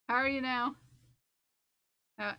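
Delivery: ordinary delivery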